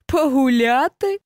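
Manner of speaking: mock the hedgehog's idea with a sneer. Hare sneering at the hedgehog's walk, mocking. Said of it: "перепитав глумливо заєць".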